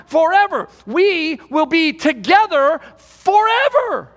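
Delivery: forever rung out high and loud, slowly; preacher lands forever, the interesting word, with a loud high push; stress on forever. Shown as a demonstration. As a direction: lift and hammer forever. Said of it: "here's the interesting word".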